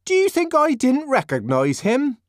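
high, loud, offended Linda; indignant. Acted. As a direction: fling it back, deeply offended. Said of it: "Linda asked indignantly".

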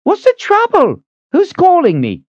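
high, brisk woman's voice; thin head cook. A narrator's reading. Head cook rushes in asking who calls, high. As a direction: ask it sharp and high.

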